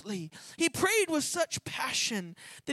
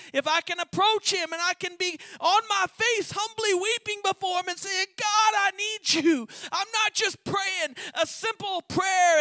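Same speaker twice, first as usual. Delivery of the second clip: high, loud, pleading prayer cry; impassioned preacher voicing a prayer; desperate preaching; 8 semitones higher, 5 dB louder than usual. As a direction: beg God like you mean it. Preaching at full force.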